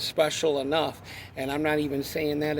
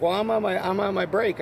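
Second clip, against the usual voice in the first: mock coworker voice; 6 semitones higher, 5 dB louder than usual. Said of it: "And he's like".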